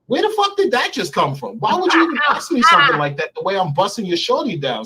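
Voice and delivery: high-pitched, indignant retelling; angry retort. Retold or imitated. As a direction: act out an offended comeback, pitch jumping way up. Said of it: "So I got angry".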